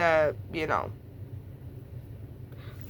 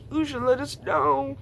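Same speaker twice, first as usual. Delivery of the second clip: parents scolding; 7 semitones higher than usual. Retold or imitated. Voicing parents in story.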